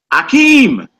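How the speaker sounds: high, loud held-out AKEEM; movie-style name call. Acted. Teacher quoting Coming to America, high drawn-out call. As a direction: call out "Akeem" like a comedy film line. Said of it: "that was Eddie Murphy's name on Coming to America".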